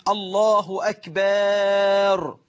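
أكبر with a long held "akbaaar"; added alif in أكبر. Shown as a demonstration. Teacher shows a worshipper's mistake, أكبر stretched to "akbaaar". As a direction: draw out the vowel before the final r of أكبر, very slowly. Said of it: "إدخال ألف بعد الباء وقبل الراء".

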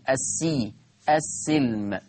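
السي، السلم with i after the s; kasra on the sin. Shown as a demonstration. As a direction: sound out السي، السلم with a short i, as-si, as-silm. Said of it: "تقرأ بكسر السين". (السي، السلم بكسر السين)

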